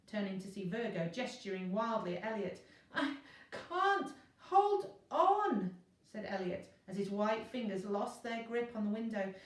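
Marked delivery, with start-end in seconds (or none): 2.9-5.8 desperate cry. Acted. Elliot about to fall.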